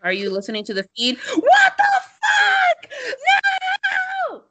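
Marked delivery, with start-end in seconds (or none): 0.0-2.9 hammed-up yelling into the radio; mock radio shout. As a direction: shout it like panicked mission control. Mock mission control shouting.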